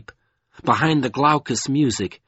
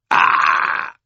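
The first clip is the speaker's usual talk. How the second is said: sharp fearful cry; 7 semitones lower, 5 dB louder than usual. A narrator's reading. Fear breaking into shriek.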